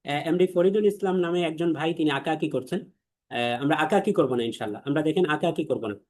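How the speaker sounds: ordinary delivery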